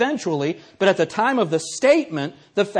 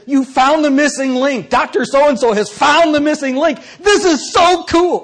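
much higher and louder, slowing into a gush; hammed-up excitement; 10 semitones higher, 10 dB louder than usual. Teasing a professor on the phone, overblown excitement. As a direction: rave about the missing link like it's huge news. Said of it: "I'm doing this just like this on the phone".

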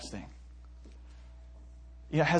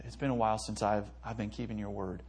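quiet prayer; 4 semitones lower, 8 dB quieter than usual. Shown as a demonstration. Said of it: "you can say".

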